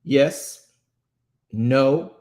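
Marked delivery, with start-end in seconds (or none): none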